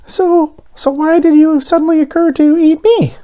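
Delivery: very high, loud insistent little voice; high demanding donut. A narrator's reading. Arnie confronts Mister Bing, high and loud. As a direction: press him for an answer. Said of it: "Arnie demanded".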